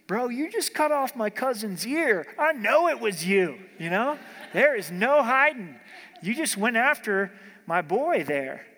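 loud, high-pitched accusation; personal confrontation. Acted. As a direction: go after him for hurting your cousin. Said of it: "This gets a little more personal".

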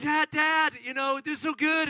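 high, loud burst of gushing; excited daughter. Acted. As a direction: bubble over telling Dad all about it. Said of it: "they're so excited".

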